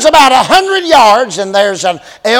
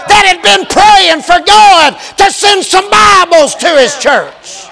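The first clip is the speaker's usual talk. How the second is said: preaching at full force; 6 semitones higher than usual. Acted. Preacher driving home God's plan to the congregation, fervent. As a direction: build the point to a ringing peak.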